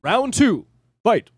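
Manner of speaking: high, loud round call; booming fighting game announcer. Pretend. Mock fighting game round start.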